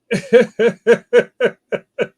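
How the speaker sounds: high, loud burst of laughter; hearty laugh. Real, not acted. Laughing at the candle gift.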